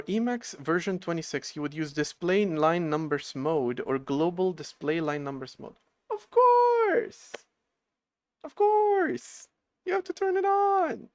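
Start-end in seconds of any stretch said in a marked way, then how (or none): 6.1-11.1 very high, loud "of course"; squeaky realization. Real, not acted. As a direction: shriek it in sudden realization. Realizing the fix.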